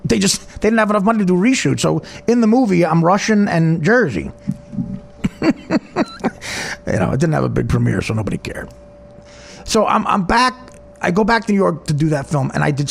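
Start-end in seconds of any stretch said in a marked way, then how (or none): 5.2-6.8 high, breathy staccato laugh; real laughter. Real, not acted. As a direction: crack up at your own story. Laughs at his botched accent story.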